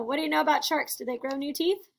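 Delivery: plain reading voice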